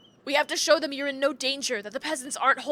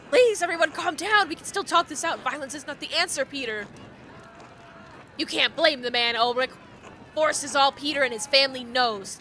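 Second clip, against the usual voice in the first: normal talking voice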